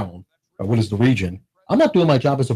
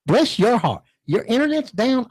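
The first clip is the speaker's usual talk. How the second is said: "Bless your heart" pitched higher with "bless" leaned on and the tune falling; sweet Southern agent; 9 semitones higher than usual. Retold or imitated. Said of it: "You sound like Tootsie".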